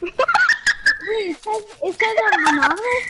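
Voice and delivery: giggling through a surprised question; laughing. Real, not acted. Gamer laughing at his teammate on voice chat, amused disbelief. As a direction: laugh while asking, can't believe it. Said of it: "Stop fucking laughing".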